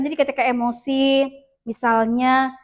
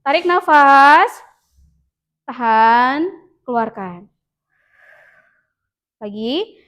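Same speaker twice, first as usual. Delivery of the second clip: guided breathing; 4 dB louder, slower than usual. Shown as a demonstration. Teacher leading pupils in a breathing exercise, slow and guiding. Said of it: "Boleh coba contohkan".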